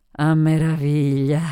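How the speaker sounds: put-on drawn-out, slow drunken reply; lightly voiced tipsy woman; drunken Sophie. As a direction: stretch it out like a drinker. Drunk Sophie answers old acquaintance.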